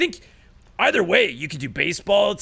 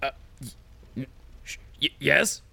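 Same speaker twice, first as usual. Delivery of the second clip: frightened stammer; 4 semitones lower, 5 dB quieter than usual. Acted. Stammering under pressure.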